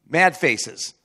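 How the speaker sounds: usual speaking voice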